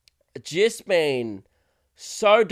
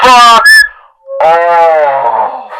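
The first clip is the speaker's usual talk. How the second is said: even louder yell; 18 dB louder than usual. Pretend. Comedian yelling again at a fan louder still, all-out. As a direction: bellow it so the far-off guy hears. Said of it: "I gotta do that again in case the guy didn't hear me".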